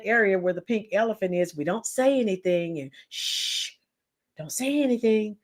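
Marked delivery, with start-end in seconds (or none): 3.1-5.4 unvoiced shush then soft, low hush. People hushing each other about the elephant, softly. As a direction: hush them like a secret.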